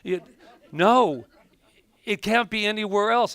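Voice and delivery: flustered after the accidental accent; flustered laughing recovery. Real, not acted. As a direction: brush off the slip, excited. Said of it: "was that a British accent".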